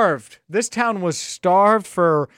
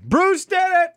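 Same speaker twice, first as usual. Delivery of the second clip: excited yell; 14 semitones higher, slower than usual. Retold or imitated. Host reliving the clinching homer for his listeners, excited. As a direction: shout it as if reliving the moment. Reprising the roommates' celebration.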